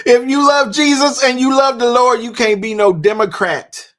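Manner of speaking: loud, full-force preacher delivery; preaching. Acted. Host preaching the clip's line back to viewers, loud and fervent. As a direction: preach it like Sunday from the pulpit. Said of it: "Boy, that'll preach in times like these".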